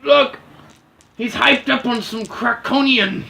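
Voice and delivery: loud, high yell to his crew; shouting order. Acted. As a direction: bellow it across the battlefield. Enemy captain yells orders.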